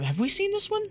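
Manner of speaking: ordinary delivery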